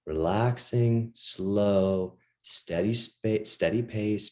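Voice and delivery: relaxed and slow; slow steady pace. Shown as a demonstration. Coach models the relaxed pace he describes. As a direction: let each word settle slowly. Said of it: "You just slow it down".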